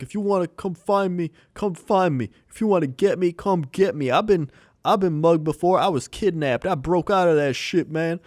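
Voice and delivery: a half-hammed tough-guy challenge, imitated; imitating a yelling man. Retelling a streamer yelling threats over the phone, mock yell. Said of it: "just yelling at some guy".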